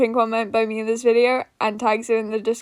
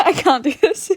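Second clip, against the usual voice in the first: real laughter breaking through the words; half laughing, half crying in giggles; 5 semitones higher than usual. Real, not acted. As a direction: get it out while cracking up.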